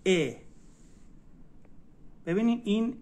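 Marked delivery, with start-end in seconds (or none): 0.1-0.3 ا voiced as "e", not English "ee"; Turkish letter E. Shown as a demonstration. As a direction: say ا as a short open "e".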